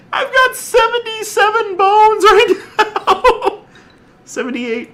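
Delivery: giggling in disbelief at the count; breaking into squeaky laughter; laughing disbelief. Real, not acted. Amazed at his bone count.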